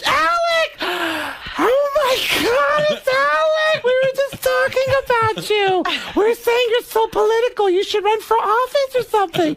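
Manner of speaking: very high, gushing shriek; Beverly D'Angelo. Retold or imitated. Clueless actress greeting her friend, high squealing impression. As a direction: squeal it like a completely clueless admirer. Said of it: "gets off the elevator and goes".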